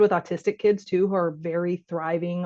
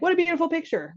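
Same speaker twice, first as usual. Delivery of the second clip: generic praise; 9 semitones higher than usual. Shown as a demonstration. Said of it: "Not awesome".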